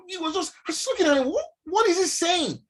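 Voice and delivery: high puzzled what is he saying; baffled. Retold or imitated. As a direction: act totally lost by what you hear. Baffled at incomprehensible patient.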